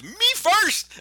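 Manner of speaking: high, loud cartoon shout; Brer Bear. Bear shoving fox out of the way, high eager shout. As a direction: shove in with a high-pitched holler. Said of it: "and just goes".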